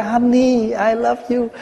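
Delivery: lightly hammed-up doting husband; mock husband. Monk mimics the husband asking for a hug, doting. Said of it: "phải hug ổng cái".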